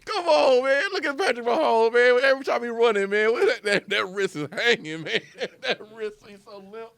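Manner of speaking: high, loud, cracking up; helpless laughing protest. Real, not acted. Co-host roasting how the quarterback runs, real high laughing outburst. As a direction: squeal it out through real laughter.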